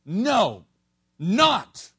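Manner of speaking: "No, not" both words punched loud, apart; two emphatic no's, each stressed. Shown as a demonstration. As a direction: hit "No" then "not" as two hard blows. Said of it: "it actually is a double negative".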